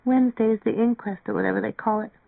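ordinary delivery, nothing marked

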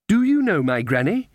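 put-on high, questioning child's voice; little girl. A narrator's reading. Child asking the witches about granny, childlike. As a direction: ask with a child's rising lilt. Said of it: "said the child".

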